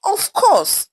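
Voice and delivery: high, loud scoffing of course; haughty scoff. A narrator's reading. Amara scoffing at her roommate's warning, haughty high exclaim. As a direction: scoff it down at them. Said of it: "simply replied haughtily".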